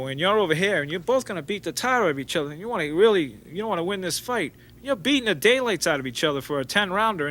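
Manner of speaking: ordinary talk, nothing marked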